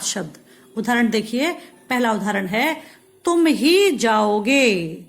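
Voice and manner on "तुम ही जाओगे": slow, with weight on ही; slow, ही pressed firmly; stress on ही. Shown as a demonstration. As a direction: lean hard on ही, only you will go. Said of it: "अब यहाँ पर ही".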